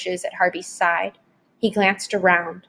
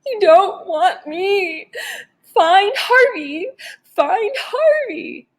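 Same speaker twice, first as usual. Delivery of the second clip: high, slow, sobbing whine; whining through tears; 11 semitones higher, slower than usual. A narrator's reading. Blubbering tearful wail, pitched way up. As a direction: Wendell cries snotty pleas to the vampire, high and slow. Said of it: "Wendell whined, all snot and tears".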